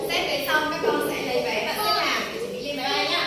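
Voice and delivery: calling out. Real, not acted. Teacher calling to the children.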